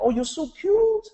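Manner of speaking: high squeaky cooing; gushing cute talk. Retold or imitated. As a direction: squeal it like an adult cooing at a toddler. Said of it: "we see this all the time".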